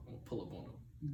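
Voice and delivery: usual speaking voice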